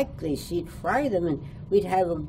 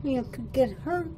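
higher, softer voice of a kindly teacher gently scolding; a light hint of a caring teacher warning a child, pitched up and hushed; mild teacherly caution, higher and softer; teacher's warning; 6 semitones higher, 5 dB quieter than usual. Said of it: "she says".

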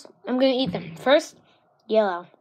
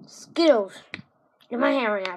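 ordinary talk, nothing marked

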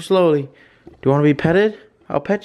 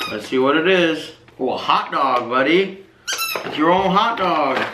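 ordinary talk, nothing marked

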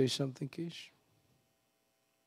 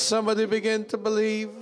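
ordinary talk

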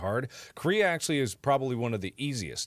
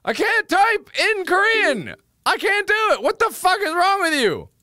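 frustrated scream; 7 dB louder than usual. Real, not acted. Streamer screams at the app that he can't type Korean. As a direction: scream at the app, at wits' end.

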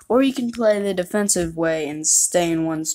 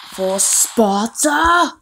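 slow, loud, higher British war cry; British Spartan battle cry; 5 semitones higher, 8 dB louder, much slower than usual. Retold or imitated. Spartans charging with a hammed-up British war cry. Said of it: "Spartans sound British. They're like".

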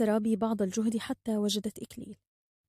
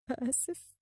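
very soft, cowed apology; breath held, whisper it in fear; meek hushed word; fearful whisper; 3 semitones higher, 9 dB quieter, much slower than usual. A narrator's reading. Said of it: "كتم الحكيم أنفاسه لفرط الخوف وهمس".

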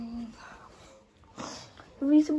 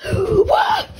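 much higher, louder yelp; startled yell; 12 semitones higher, 16 dB louder than usual. Real, not acted. Player startled in the bedroom, startled yell. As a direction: blurt it in shock.

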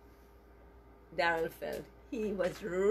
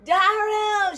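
loud, much higher call of the name; mother shouting; 12 semitones higher, 9 dB louder than usual. Retold or imitated. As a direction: yell the name across the house. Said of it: "she shouted".

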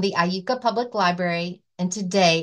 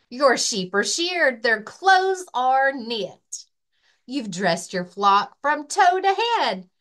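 lively, sing-song picture book reading; reading to children. Acted. Librarian reading a rhyming book to children, lively. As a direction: read it like story time for little kids. Library story time read-aloud.

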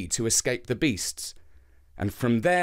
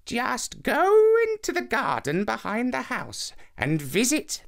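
put-on old lady; 6 semitones higher than usual. A narrator's reading. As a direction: calmly explain like a wise old woman. Old lady instructs Anansi.

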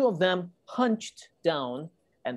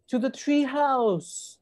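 high, drawn-out rallying call; 9 semitones higher, slower than usual. A narrator's reading. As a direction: call it high, urging a friend to run. Jack keeps crying out in story.

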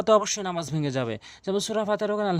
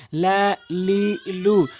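drawn-out vowels; 4 dB louder, much slower than usual. Shown as a demonstration. Teacher demonstrating long vowels to viewers, slow and stretched. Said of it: "বারবার উল্টো সিধে করে পড়বেন".

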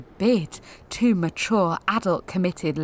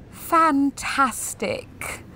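sarcastic; 6 semitones higher, much slower than usual. Real, not acted. Sarcastic comment on crying.

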